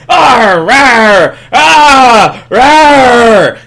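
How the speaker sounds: loud, high, strained monster roar; dinosaur roaring in pain. Retold or imitated. Baryonyx hit by lava, loud pained roaring. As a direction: roar like a burned beast, full volume. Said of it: "baryonyx is like".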